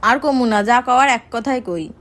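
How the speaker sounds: ordinary talk, nothing marked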